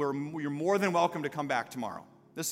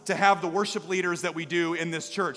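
rallying the church; 4 semitones higher, 7 dB louder than usual. Acted. Rallying congregation.

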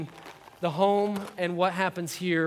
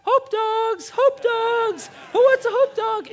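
put-on very high, loud little-girl yell; imitating six-year-old daughter; 8 dB louder, slower than usual. Six-year-old copying dad at the parade, squeaky yelling. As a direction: squeal it out like a tiny hot dog vendor. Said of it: "this is what she does. She goes".

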